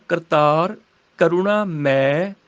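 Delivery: ਮੈਂ as long open 'mai' without nasal; model pronunciation. Shown as a demonstration. Teacher modelling the line, slow ਕਰੁਣਾ and open un-nasal ਮੈਂ. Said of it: "ਇਹਦਾ ਉਚਾਰਨ ਹੋਏਗਾ".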